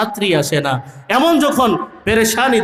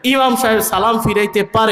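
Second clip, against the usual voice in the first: normal talking voice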